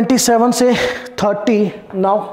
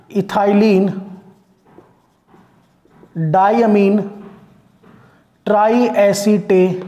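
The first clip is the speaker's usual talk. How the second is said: word parts separated by long pauses; slow dictation; much slower than usual. Shown as a demonstration. Teacher dictating the name as a ligand, slow dictation. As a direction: leave time to write after each part.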